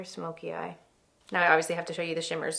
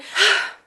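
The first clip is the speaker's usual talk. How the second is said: dramatic sigh; 14 semitones higher, 10 dB louder than usual. Real, not acted. Dreamy reaction to makeup looks.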